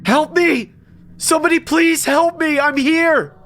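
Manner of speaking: very high, frantic scream; panicked high-pitched shouting for rescue; screaming for help. A narrator's reading. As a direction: scream desperately to be heard. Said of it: "I screamed as loud as I could".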